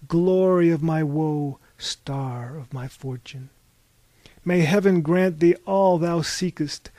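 slow, chivalrous ode, starting loud and ending hushed; lofty declamation. A narrator's reading. Wanderers invoke the knight's lady at her door, lofty declamation. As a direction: declaim it grandly like a knight's invocation.

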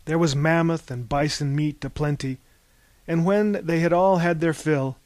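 ordinary delivery

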